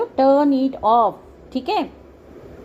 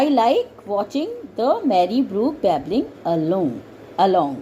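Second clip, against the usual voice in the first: slow reading; 3 semitones lower, much slower than usual. Shown as a demonstration. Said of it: "reads filled answer".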